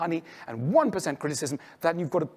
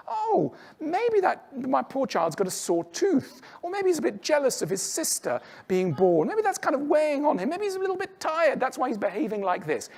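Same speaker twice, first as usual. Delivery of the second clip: patient parent; 8 semitones higher than usual. Retold or imitated. A modern parent excusing their toddler's tantrum, gently tender. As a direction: coo it like a parent forgiving a tantrum. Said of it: "You go".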